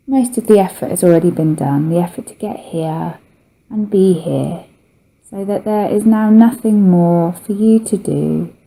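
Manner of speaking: ordinary talk, nothing marked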